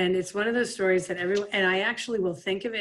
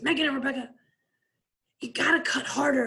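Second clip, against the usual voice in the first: raised, high scolding coach voice; 6 semitones higher, faster than usual. Retold or imitated. Coach telling players to cut harder, scolding. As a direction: scold the players from half court. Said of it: "He goes".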